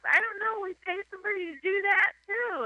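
high, entitled whine; imitated pampered wife. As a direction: sniff it like a spoiled rich wife. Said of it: "entitled wife again".

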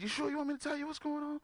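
a mock-innocent you sure; warning his therapist, high and teasing. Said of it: "I said".

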